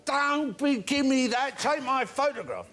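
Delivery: grab at the camera, insisting; high shouting drunk; drunk fan. Retold or imitated. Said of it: "He said".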